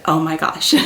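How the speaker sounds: ordinary talk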